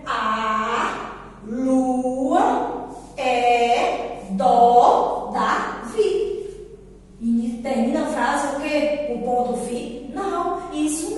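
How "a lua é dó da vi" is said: "A lua é" very slow, every vowel held; slow syllable reading. Shown as a demonstration. Teacher reading the sentence with children, very slow and drawn out. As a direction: read "dó da vi" in long separate beats. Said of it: "Agora vamos ler a frase".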